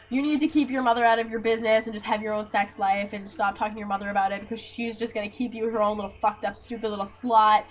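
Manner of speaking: ordinary delivery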